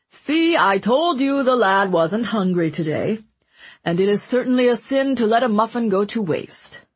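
jovial glutton voice; Mancini voice. A narrator's reading. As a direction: boom it out like a greedy jester. Mancini justifying taking the muffin.

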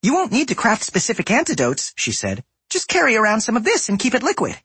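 put-on high bright goblin girl; sweet eager young woman. A narrator's reading. Voices Safi.

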